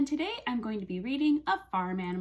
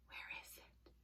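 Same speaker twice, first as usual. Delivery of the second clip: hushed, barely voiced muttering; searching whisper; 26 dB quieter, faster than usual. Teacher looks for her toy cow, searching whisper. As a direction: whisper as you hunt around.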